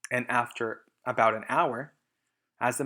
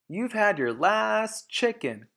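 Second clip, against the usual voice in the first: slow, high, louder threat; yell; 9 semitones higher, 5 dB louder, slower than usual. Acted. Boggis yelling at Mister Fox, slow and menacing. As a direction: spit the threat out. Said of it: "yelled Boggis".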